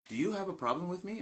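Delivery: high-pitched mock challenge; imitated confrontational question; confronting. Retold confrontation by the student.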